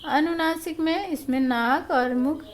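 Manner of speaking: usual speaking voice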